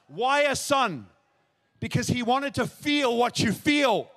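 slow, high, ringing proclamation; impassioned preaching. Real, not acted. Preacher tells the crowd the world is them, fervent slow preaching. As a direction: drive each phrase out to the whole crowd.

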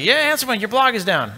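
high, sing-song mock of a tweeting crowd; mocking Twitter users. Twitter users telling the host his blog is down, high sing-song mock. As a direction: imitate the internet gleefully pointing out the outage. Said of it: "So Twitter is like".

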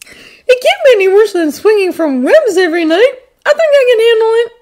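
put-on high, loud boy voice; playful kid. A narrator's reading. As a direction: answer back playfully in a high voice. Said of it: "changing into a playful expression".

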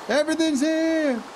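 high, relieved cry of joy; excited. Real, not acted. Player sees all his items, high excited relief. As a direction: burst out with relief.

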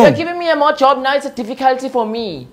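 high, loud complaint; exasperated cashier. Acted. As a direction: complain loudly, fed up. Cashier argues back.

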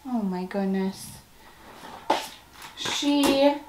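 usual speaking voice

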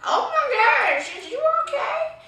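hammed-up high worried squeal; mock panic. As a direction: squeak out concern over a screaming friend. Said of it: "I was like".

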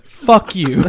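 laughing mock insult, cracking up. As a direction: spit it out then burst laughing.

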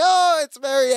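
high-pitched hammed-up delight; mock delighted greeting. Co-host acts Larry greeting Marianne, mock delight. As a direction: exclaim it high, imitating relief.

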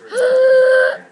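sharp loud gasp; shocked gasp. Real, not acted. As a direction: gasp in horror at the mess. Dip spilled on mom's laptop.

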